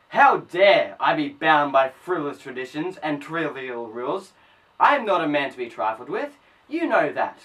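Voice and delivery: loud, forceful defiance; indignant outburst. Acted. Man rebels against frivolous traditions before Missus Reynolds, indignant. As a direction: come in hard and loud, outraged.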